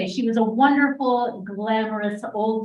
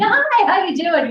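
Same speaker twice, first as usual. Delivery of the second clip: high, bright greeting from a butcher; cheery butcher voice; 11 semitones higher, 6 dB louder than usual. Retold or imitated. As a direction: chirp it like a jolly shopkeeper. Voicing merry butcher shop staff.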